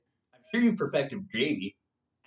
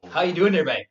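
put-on Newfie accent; 4 semitones higher, 7 dB louder than usual. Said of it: "I started calling people".

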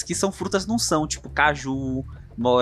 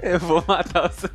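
laughter in the voice; laughing; 14 semitones higher than usual. Real, not acted. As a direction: still giggling while talking. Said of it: "não tô conseguindo parar de rir".

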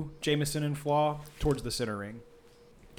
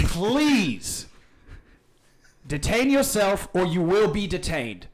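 loud, higher-pitched official warning; officious threat; 5 semitones higher, 5 dB louder, slower than usual. Acted. Clyde threatening the noisemaker with detention, loud and officious.

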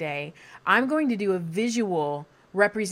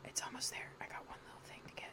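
unvoiced focused whisper; leaning in to whisper; 23 dB quieter, much faster than usual. Real, not acted. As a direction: whisper while concentrating. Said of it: "Use your whisper voice".

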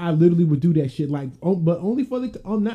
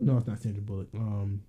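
ordinary talk

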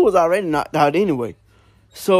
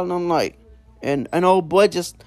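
usual speaking voice

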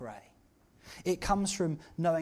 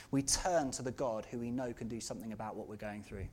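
ordinary talk

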